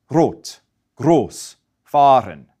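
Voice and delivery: "rot", "groß", "fahren" with a tapped tongue-tip R, slowly; Austrian-style R tapped at the front, unhurried. Shown as a demonstration. Teacher showing how Austrians say these words, tongue-tip R. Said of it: "they use what we call the tip of the tongue R".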